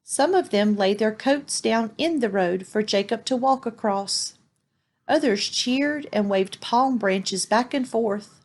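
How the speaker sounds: ordinary delivery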